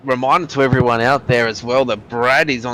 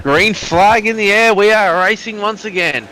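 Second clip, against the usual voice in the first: high, loud race restart call; motorsport commentator; 7 semitones higher, 3 dB louder than usual. Real, not acted.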